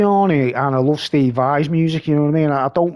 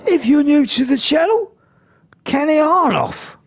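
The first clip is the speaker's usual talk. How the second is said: higher mock YouTube intro; a hint of his friend's channel voice; imitating the friend; 9 semitones higher than usual. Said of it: "He talks like this".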